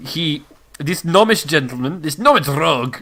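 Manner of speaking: put-on European-accented baron rolling the R on "rogue"; muddled European accent, rolled R. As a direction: proclaim in a botched European accent. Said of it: "in a European accent without rolling the R".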